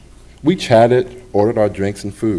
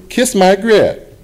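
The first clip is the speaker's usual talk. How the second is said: high, slow, loud sassy waitress drawl; loud sassy catchphrase; 12 semitones higher, 5 dB louder, much slower than usual. Retold or imitated. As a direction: belt it out like the TV waitress Flo. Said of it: "the loud talking one who said".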